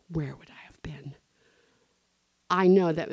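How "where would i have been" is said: hushed, breathy question; whispered reflection. Real, not acted. Asking the audience where she'd be without AA, whispered. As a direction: let it fall to a whisper. Emotional reflection on her life.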